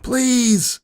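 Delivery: very high, loud begging cry; desperate scream. Retold or imitated. Narrator screaming please at her past self. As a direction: scream it with everything. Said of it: "I screamed, louder than I ever heard".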